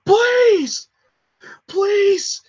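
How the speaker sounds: high, loud pleading with a sob; desperate yelling. Acted. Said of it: "And he's just yelling".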